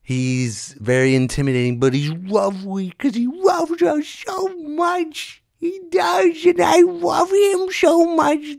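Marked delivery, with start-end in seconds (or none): 1.8-8.6 put-on very high, slow squealing baby voice; gushing baby talk. Comic overflows with love for dog, squeaky gushing. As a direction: squeal it out, hopelessly smitten.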